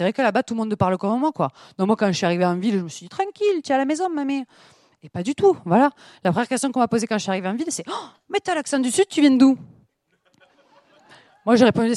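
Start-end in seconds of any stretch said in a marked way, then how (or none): 3.1-4.4 high-pitched, easygoing pep talk to yourself; self-reassurance. Retold or imitated. Newcomer telling herself she fits in, high-pitched self-reassurance. 7.9-9.6 high, excited mock amazement; mock surprise. Townspeople spotting her southern accent, mock astonished.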